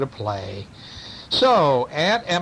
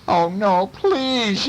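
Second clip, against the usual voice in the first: higher cry of dread; pleading yell; 6 semitones higher, much slower than usual. A narrator's reading. Burglar hears cats may bite him, pleading yell. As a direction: beg in acted alarm. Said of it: "yelled the burglar".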